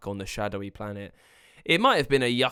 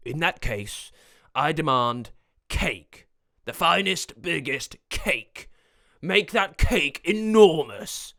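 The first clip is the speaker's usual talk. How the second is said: slow, emphatic demand, building louder; demanding wizard; 5 semitones higher, 4 dB louder, slower than usual. Acted. Wizard demanding an enormous cake, slow and imperious. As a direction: order it slowly, swelling at the end.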